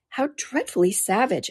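drawn-out, appalled little outcry; shocked exclamation. Acted. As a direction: exclaim it in hushed dismay. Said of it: "exclaimed Alice".

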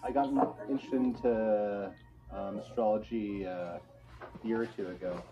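normal talking voice

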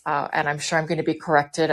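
ordinary talk, nothing marked

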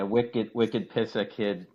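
slow, heavy Boston slang; Boston accent. Shown as a demonstration. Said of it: "I can do a real Boston".

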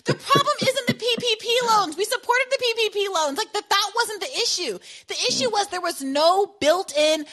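high, loud exasperated rant; heated shout. Real, not acted. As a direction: shout it out in frustration. Heated rant about loans.

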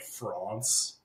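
"France" with a long ah, like "Frahnce"; mock-pretentious "France", broad open ah; affected pronunciation. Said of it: "like, f-, calling it France".

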